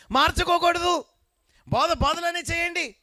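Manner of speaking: rising to a high, loud preaching cry; preaching build-up. Acted. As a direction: build the point up to a shout. Preacher exhorts congregation. (పెరుగుతున్న ఉత్సాహంతో ప్రసంగం)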